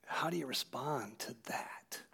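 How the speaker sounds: usual speaking voice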